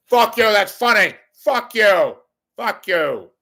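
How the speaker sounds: high, loud shouted punchline; mock outburst. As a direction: yell it at the crowd like a comic. Comic's punchline shouts at audience.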